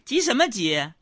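high-pitched mother's voice; acted motherly scolding; mom's retort. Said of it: "我妈说".

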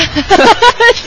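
high loud burst of laughter; laughing. Real, not acted. Laughs at name joke. (哈哈大笑)

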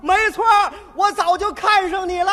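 loud high yell; girl yelling at the cyclist; shouting. Retold or imitated. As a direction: shout him down.

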